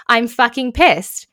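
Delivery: loud, slow emphatic outburst; angry client. Acted. Client venting to mediator, angry.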